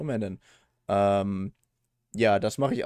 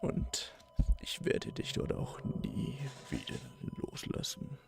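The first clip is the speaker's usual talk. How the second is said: eerie unvoiced whisper; creepy whisper; 8 dB quieter than usual. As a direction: breathe it like a stalker.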